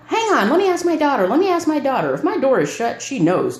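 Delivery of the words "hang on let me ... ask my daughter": higher imitation of mom; quoting mom. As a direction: mimic her mom calling out. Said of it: "She's banging on my door".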